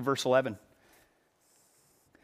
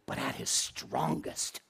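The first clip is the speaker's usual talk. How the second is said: slow, hushed whisper; breathless whispered reveal; 11 dB quieter, slower than usual. Real, not acted. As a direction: drop to a secret whisper for the reveal. Dramatic reveal in a sermon.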